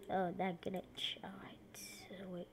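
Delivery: hushed annoyed whisper. Real, not acted. Gamer muttering about a glitch, whisper. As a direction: breathe it out under your breath.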